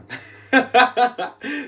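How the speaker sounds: loud, high-pitched tired laughing fit; sudden loud self-conscious laugh; real laugh. Real, not acted. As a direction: burst out laughing at yourself. Loses track, laughs at self.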